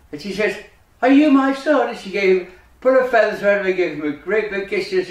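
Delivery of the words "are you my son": high, louder astonished question; amazed mother. Acted. Mummy recognises her lost son, amazed. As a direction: ask it in raised disbelief.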